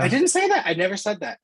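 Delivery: real startled objection; surprised denial. Real, not acted. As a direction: pitch jumps high in quick protest. Denies being misquoted.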